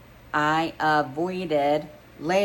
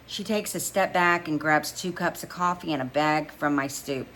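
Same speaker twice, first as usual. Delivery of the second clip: usual speaking voice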